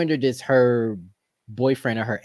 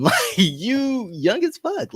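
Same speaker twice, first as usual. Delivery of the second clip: laughing disbelief; 13 semitones higher, much slower than usual. Retold or imitated. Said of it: "I'm like".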